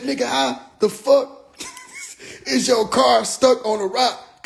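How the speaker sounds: high, slow disbelieving question; mock maternal disbelief, high and stretched; incredulous mom. Acts out mother's disbelief on phone.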